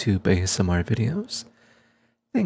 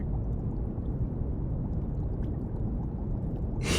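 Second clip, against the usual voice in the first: long, hushed breathy chuckle; quiet teasing laugh; 8 dB quieter than usual. Acted. Researcher teasing the stubborn bodyguard, quiet laugh. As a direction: laugh softly, teasing a friend.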